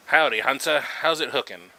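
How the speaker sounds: over-the-top Australian twang, booming and high; Australian accent. Acted. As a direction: play up a cheery Australian angler greeting the hunter. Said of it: "I don't know why I made him Australian".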